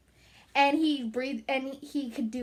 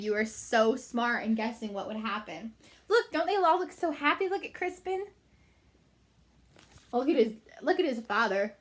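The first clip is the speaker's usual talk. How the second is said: plain reading voice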